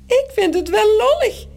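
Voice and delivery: squeak. Acted. Boy squeaks in the girl's voice, amused. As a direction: pipe it up high. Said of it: "piept Morrel".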